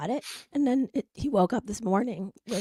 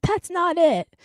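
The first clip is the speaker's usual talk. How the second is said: put-on high, louder protesting child; indignant kid; 7 semitones higher, 8 dB louder, slower than usual. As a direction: object like a small boy who spots the trick. Son catches the fake.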